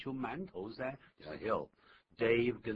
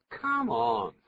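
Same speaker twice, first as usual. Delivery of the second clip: scornful come on; 3 semitones higher, 8 dB louder than usual. Shown as a demonstration. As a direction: Come on with a louder, drawn-out stress on come, like "get out of here". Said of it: "这意思完全不一样了啊".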